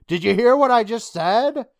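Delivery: loud, high-pitched retold challenge; indignant demand. Kid arguing back at a hostile girl, indignant. Acted argument between rival kids.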